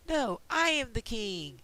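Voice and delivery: slow, stubborn king stressing I; the first king digs in, slowly; first king insisting. Acted. They're having their battle for the throne.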